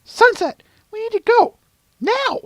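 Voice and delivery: loud, very high-pitched urgent voice; insistent Twilight Sparkle. Acted. Pressing Sunset to leave at once, loud and high. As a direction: press hard in a high heroine's voice.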